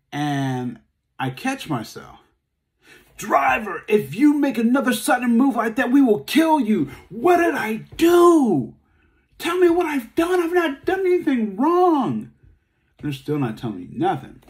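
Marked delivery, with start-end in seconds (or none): 3.2-6.9 high, loud shouted cop threat; loud mock police yell. Police threatening him again. 7.1-12.3 high, loud crying shout; loud crying plea of innocence; terrified cry. Retold or imitated. Pleading terrified with police.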